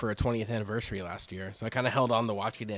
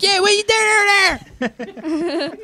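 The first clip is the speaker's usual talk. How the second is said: much higher, squeaky filtered Philly line; filtered silly voice; 8 dB louder than usual. Retold or imitated. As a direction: sound like a video filter pitched way up. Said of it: "We'll throw filters on it".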